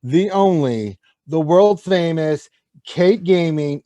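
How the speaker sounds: loud, slow big-show announcer. Acted. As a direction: build up the grand introduction. Announcing the next player.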